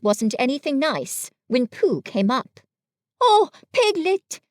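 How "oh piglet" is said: high, louder eager call; excited. A narrator's reading. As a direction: burst out with the greeting, pitched way up. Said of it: "said Pooh excitedly".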